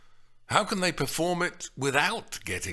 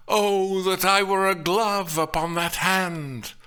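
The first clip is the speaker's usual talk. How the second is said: lovestruck Romeo; 7 semitones higher, 5 dB louder than usual. Acted. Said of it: "Romeo in the balcony scene exclaims".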